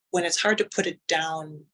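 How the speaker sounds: ordinary talk, nothing marked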